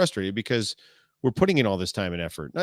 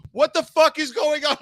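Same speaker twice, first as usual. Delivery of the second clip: high, louder mock yell; retold yell; 4 dB louder than usual. Co-host left behind, yelling at the panicked team. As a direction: throw up your hands and holler it. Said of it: "I'm, like, yelling around".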